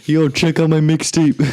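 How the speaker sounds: loud, high hustler hawking a mixtape. Retold or imitated. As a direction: push the mixtape on passersby. Mocking a street mixtape pusher.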